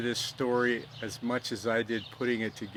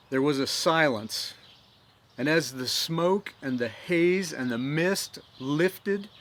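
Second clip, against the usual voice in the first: dramatic storytelling voice, rising with the battle; story narration; 3 semitones higher, 3 dB louder than usual. A narrator's reading. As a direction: tell it like a stirring tale of history. Storyteller narrates Fort McHenry history.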